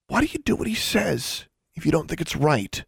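high-pitched, insistent question; demanding. A narrator's reading. Sister questioning brother's obedience, demanding. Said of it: "she demanded".

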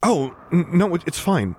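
high, stammering reassurance; hurried reassurance. A narrator's reading. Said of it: "he hurried to reassure".

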